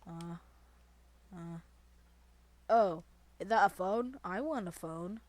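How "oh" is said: normal talking voice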